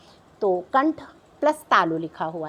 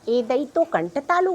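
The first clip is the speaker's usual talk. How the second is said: ordinary talk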